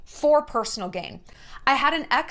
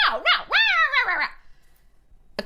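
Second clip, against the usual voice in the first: high yelling gibberish fading to breath; mock explosion; slower than usual. Ex exploding at her, mock high ranting. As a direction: parody his outburst, high and ranting. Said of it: "And he exploded".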